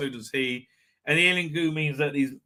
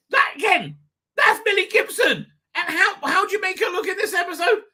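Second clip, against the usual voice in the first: much higher, exasperated outburst; outraged disbelief; 12 semitones higher, 5 dB louder than usual. Real, not acted. Outraged at the show for styling her badly, high-pitched.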